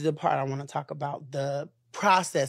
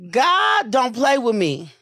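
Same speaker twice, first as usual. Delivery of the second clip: emphatic testimony; 4 semitones higher, 6 dB louder than usual. Real, not acted. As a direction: shout it out like testifying in church. Testifying about surviving the attack.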